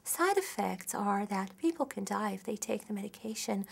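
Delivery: soft, soothing commercial voice-over; gently gloss over the bad news; exaggeratedly calm, softened disclaimer voice. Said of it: "calm and soothing voice".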